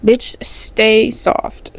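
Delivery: ordinary delivery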